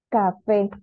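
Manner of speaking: Café sounded out as ca, FÉ; stress on last syllable. Shown as a demonstration. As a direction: land firmly on FÉ in Café. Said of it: "Última sílaba".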